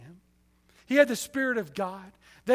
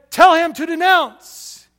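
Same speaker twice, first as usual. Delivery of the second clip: loud, slow, higher command; retold order; 6 semitones higher, 11 dB louder, slower than usual. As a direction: lean on each word like a captor. Gunmen order the wife.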